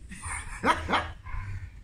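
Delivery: breathy, voiceless burst of laughing; burst of laughter. Retold or imitated. Colleague bursts out laughing at the question, breathy laugh. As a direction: let out an airy laugh at the question. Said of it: "Il éclate de rire".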